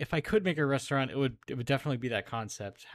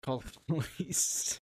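laughing; 2 semitones higher, 3 dB quieter than usual. Real, not acted. Laughing while repeating.

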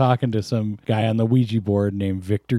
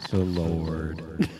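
slightly lower, slow, a hint of a solemn divine voice; lightly voiced Lord; 4 semitones lower, 6 dB quieter, slower than usual. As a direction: speak as God on the phone, mildly. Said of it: "Yes, my son. This is the Lord".

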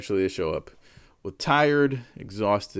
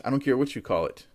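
ordinary talk

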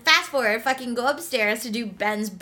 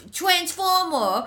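put-on slow, higher toddler voice; little kid's mispronunciation; 6 semitones higher, slower than usual. Imitating three-year-old Henry naming his toy, toddler voice. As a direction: imitate a small child garbling the word. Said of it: "I can't do impressions".

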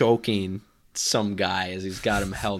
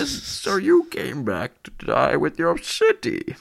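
higher, slow menacing masked-villain line; Bane impression; 6 semitones higher, 4 dB louder, slower than usual. Podcaster voicing Bane confronting Batman. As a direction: loom over him with a slow threat. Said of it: "It's a great Bale voice".

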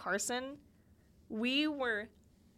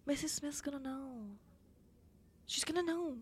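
put-on hushed kid warning; 3 semitones higher, 7 dB quieter than usual. Kids hiding hand-holding from teacher.

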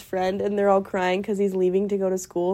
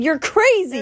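teasing retort; 8 semitones higher, 3 dB louder than usual. Retold or imitated. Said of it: "And I said".

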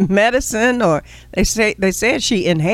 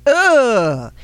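disgusted ugh; 5 semitones higher than usual. Acted.

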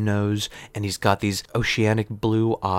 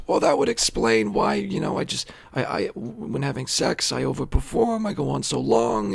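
high, raspy comic patient; 10 semitones higher than usual. Acted. Patient explaining his bedroom stamina to the doctor, high raspy voice. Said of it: "raspy voice and the third testicle".

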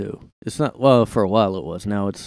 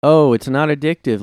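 mocking; 6 semitones higher, 8 dB louder than usual. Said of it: "they always say".

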